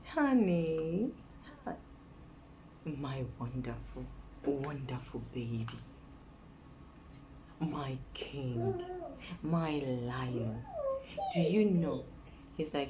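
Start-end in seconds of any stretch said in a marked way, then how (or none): 0.0-10.6 butter him up with lingering pet names; sweet-talking. Pretend. Woman flattering her man to get something, sweet-talking. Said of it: "you go to a typical Nigerian man".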